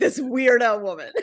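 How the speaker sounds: amused retelling, breaking into laughter; laughing mock gossip. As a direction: imitate gossips while cracking up. Mock gossip retold while laughing.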